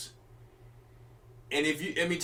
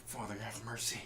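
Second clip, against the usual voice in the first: low, hushed pleading; a beaten man begging mercy, low and soft; Esau's plea; 8 semitones lower, 15 dB quieter than usual. Retold or imitated. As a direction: whisper a desperate prayer low and soft. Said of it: "this is how Esau said".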